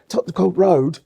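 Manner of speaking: overdone British accent with a heavy D; attempted British accent. Shown as a demonstration. Showing off London street name, attempted British accent. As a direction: put on British, overhitting the D in "Road". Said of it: "The emphasis of the D is too much".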